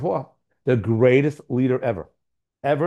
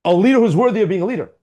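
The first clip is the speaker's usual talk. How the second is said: louder and higher, hammering the words home; loud emphasis; 6 semitones higher, 6 dB louder than usual. Real, not acted. Rabbi to his listeners, loud proclaiming emphasis. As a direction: proclaim it to the whole room. Repeats line, loud then quiet.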